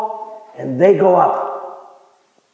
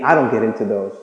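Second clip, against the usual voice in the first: deadpan joke; 10 semitones lower, 4 dB quieter than usual. Real, not acted. Self-mocking joke.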